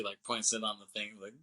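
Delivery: normal talking voice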